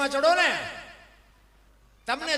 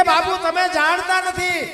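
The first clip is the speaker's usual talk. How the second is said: urgent warning; 8 semitones higher, 7 dB louder than usual. Acted. Girl warns the saint.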